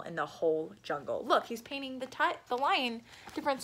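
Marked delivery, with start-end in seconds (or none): none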